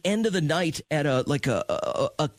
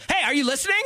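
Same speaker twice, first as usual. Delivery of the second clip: high-pitched imitation of an annoyed wife; exasperated high call, hammed-up; mock irritated demand; 13 semitones higher, much faster than usual. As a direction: demand attention, voice jumping way up. Wife snapping at inattentive husband.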